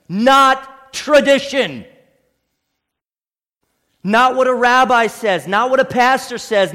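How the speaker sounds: loud, emphatic pulpit declaration; full-force preaching. Acted. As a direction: thunder it from the pulpit.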